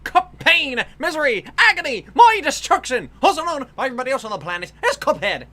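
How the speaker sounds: loud, high Irish YouTuber intro; Jacksepticeye. Acted. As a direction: shout it like an over-the-top show opener.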